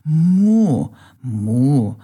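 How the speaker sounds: bright, loud, high cow's moo, then settling; happy moo. Acted. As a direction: moo with delight like a curious cow. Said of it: "Carola freute sich". (fröhliches lautes Muhen einer Kuh)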